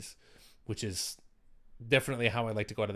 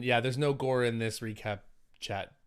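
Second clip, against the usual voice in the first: ordinary talk, nothing marked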